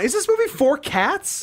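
high-pitched silly question; high mock question.

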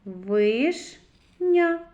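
Вишня split into ВИШ, pause, a loud НЯ; syllable by syllable. Shown as a demonstration. Teacher reads with children, syllable by syllable. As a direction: read Вишня as two separated syllables, Виш – ня. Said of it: "Прочитаємо".

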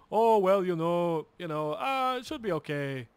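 mock hemming and hawing doctor; Doctor Peterson. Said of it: "and he goes".

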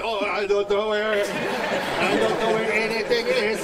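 dopey mimicry of a clueless kid; mock the child's excuse; loud, drawn-out dumb imitation; stupid mocking voice. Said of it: "repeat what I said in a stupid voice".

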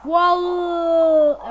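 loud amazed wow. Real, not acted. Sudden big win.

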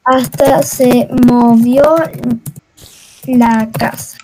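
low, stretched-out syllables; slow, low-pitched deliberate delivery; slow and halting. Real, not acted. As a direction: say it word by word, very slowly and low. Slow first take, then quick repeat.

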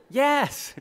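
high, loud yes; excited yes. Retold or imitated. Sister confirms to Rick she's the nerdy one, thrilled.

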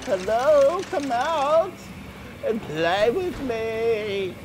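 high, slow creepy coaxing voice; creepy singsong lure. Acted. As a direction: lure them out sweetly, like a ghost child. Playing creepy luring voice.

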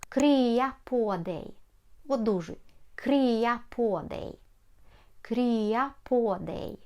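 "Krya på dig" said very slowly for learners; slow teaching pace; slow teaching demo. Shown as a demonstration. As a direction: spell it out slowly. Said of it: "teacher slows the phrase".

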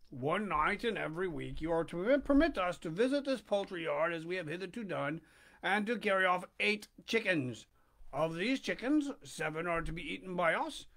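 steady storybook reading voice; story narration. A narrator's reading. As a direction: read it to the listeners like a bedtime chapter. Reads Pinocchio aloud.

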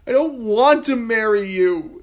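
high, loud wail; grieving girl wailing at the king. A narrator's reading. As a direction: cry it out in despair. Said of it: "Sansa wailed".

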